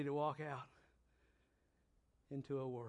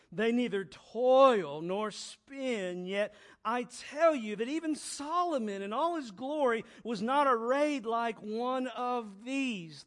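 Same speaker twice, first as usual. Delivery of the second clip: loud, slow, raised pulpit reading; booming sermon voice; preaching at full force; 8 semitones higher, 9 dB louder than usual. Acted. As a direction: proclaim it to the whole church. Preaching scripture loudly.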